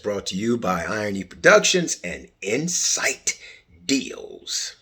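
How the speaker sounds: ordinary talk, nothing marked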